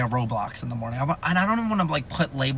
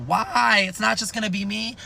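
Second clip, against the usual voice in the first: higher pitched, half-hearted mock crying; mock sobbing whine; 7 semitones higher than usual. Toddler wailing at news of twin brothers, mock sobbing whine. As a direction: imitate a wailing toddler, lightly. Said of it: "bawling my eyes out sobbing".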